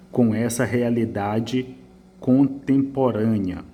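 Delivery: reading the student's phrase very slowly. Shown as a demonstration. Teacher reading the essay aloud slowly. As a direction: drag out "com essa realidade contemporânea". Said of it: "vai de encontro".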